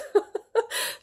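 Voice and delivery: short airy laugh under the breath; high airy giggle; real laugh. Real, not acted. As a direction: laugh at yourself, mostly breath. Laughs at her own rant.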